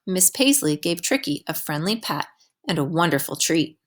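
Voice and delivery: ordinary delivery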